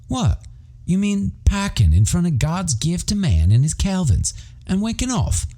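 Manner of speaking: a teasing put-on Aussie voice, high and bouncy; mock Aussie accent. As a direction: tease your Aussie mate in his own accent. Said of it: "giving my best impression of an Aussie accent".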